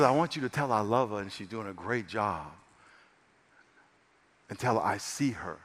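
low, soft reassurance; tender voice of God. Retold or imitated. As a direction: give God's answer quietly and gently. Voicing God's tender answer.

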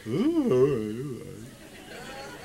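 low, reluctant cartoon-dog groan; Scooby-Doo. Father imitating son grudgingly agreeing, goofy dog groan. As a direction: mumble it like a goofy dog, fading away. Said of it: "He sounds like Scooby-Doo".

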